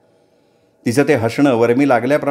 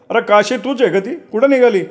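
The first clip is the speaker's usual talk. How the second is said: calling out from the cart; 10 semitones higher than usual. A narrator's reading. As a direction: call across the road to Kashi. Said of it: "कोणीतरी ओरडून विचारलं". (गाडीतून काशीला हाक मारणारा म्हातारा, मोठ्याने ओरडून)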